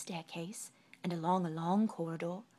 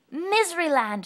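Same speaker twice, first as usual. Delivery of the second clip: high, loud sing-song answer; Little Miss Sunshine answers cheerfully; bright reply; 9 semitones higher, 14 dB louder than usual. Acted. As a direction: answer brightly, falling off at the end. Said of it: "she replied".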